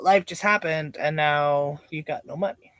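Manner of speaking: ordinary talk, nothing marked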